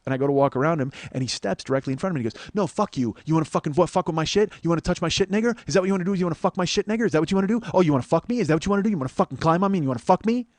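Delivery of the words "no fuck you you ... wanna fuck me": mock confrontational tirade, pitched up; rapid-fire taunting questions, acting out the aggressor; retold aggressive rant. Stranger getting in the comedian's face, retold angry challenge.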